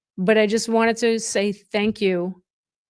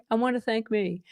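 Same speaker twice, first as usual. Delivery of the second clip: a hint of [name], softer and laid-back; [name] impression; 5 dB quieter than usual. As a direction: lightly imitate [name] thanking himself. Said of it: "Oh my God, [name]".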